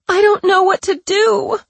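high, desperate and loud; panicked. Pretend. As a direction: cry out in panic. Patricia's faked panic.

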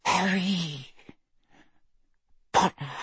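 high, soft, faint elf voice; dying elf. A narrator's reading. Dobby's last words to Harry, faint and failing. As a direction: force out the words weakly, with a long pause. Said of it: "trembled with the effort to form words".

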